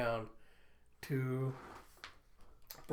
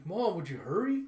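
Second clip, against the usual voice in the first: put-on urgent child; 4 semitones higher, 6 dB louder than usual. Child urges mother to flee.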